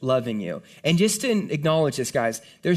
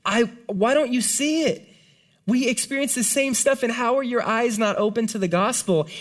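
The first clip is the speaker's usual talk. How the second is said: heartbroken plea; 8 semitones higher than usual. Retold or imitated. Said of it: "he's just like".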